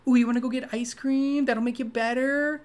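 high-pitched imitation of cheering up a toddler; consoling a crying child. Woman offering ice cream to a crying kid, high coaxing babytalk. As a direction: coax a small child out of tears. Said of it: "like a little kid who's crying".

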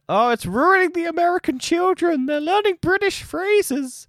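high, squeaky mock-panic voice of a fussing grown-up; mocking outraged parent. Mocking worried parents fretting over Peppa Pig. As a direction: ham up a scandalised parent clutching their pearls. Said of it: "they're like".

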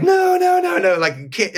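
high, loud panicky no no no; panicked protest. Retold or imitated. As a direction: squeal out a frantic refusal. Retelling panic in mummy bag.

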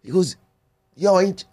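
high, eager yo wait wait; excited recognition. Retold or imitated. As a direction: drunk suddenly starstruck. Drunk recognizes him mid-fight.

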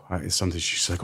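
ordinary talk

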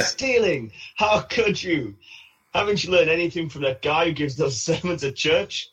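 mock Homer rant. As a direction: do Homer scolding Bart, high and mock-outraged. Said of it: "Homer's yelling at him".

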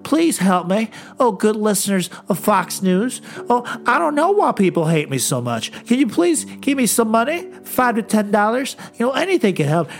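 whiny beggar senator. Retold or imitated. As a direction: beg pathetically for money like a groveling politician. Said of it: "it's like".

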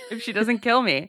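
words spilling out through laughter; laughing. Real, not acted. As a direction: say it while cracking up. Joke about Reva killing her.

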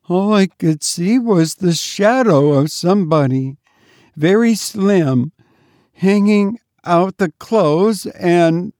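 high, faint, halting account; frightened maid. A narrator's reading. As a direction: tell it in hushed dread, pitched high. Terrified maid reporting what she saw.

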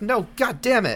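high-pitched exasperated protest; mock frustration. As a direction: protest in acted dismay. Acted frustrated audience reaction.

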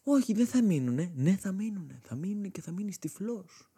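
put-on high, whiny kid and grandma arguing; high childish back-and-forth. A boy argues with his grandma about going blind, high and whiny. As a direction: squeak both sides of the quarrel. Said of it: "Και τη λέω".

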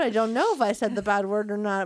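usual speaking voice

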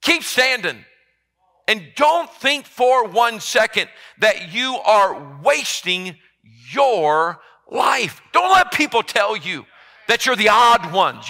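loud, high, driving sermon delivery; preaching at full force. Acted. Pastor urging his flock not to feel wasted, preaching hard. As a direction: pound every phrase out to the back pews.

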